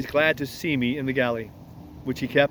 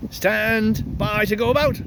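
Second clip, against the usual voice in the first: higher, slow parrot squawk; parrot scream; 7 semitones higher than usual. Acted. Parrot screaming ship's order, much higher. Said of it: "the parrot would scream".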